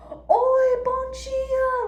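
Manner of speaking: high, loud called-out "Oi, bon dia"; loud passing greeting. Retold or imitated. Scene: a motorcyclist hailing her as he passes, loud and high. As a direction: call out cheerfully as you ride past. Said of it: "riding their motorcycle past us and be like".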